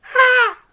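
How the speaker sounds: high, loud startled scream; alarming shout. Acted. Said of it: "I gave an alarming shout".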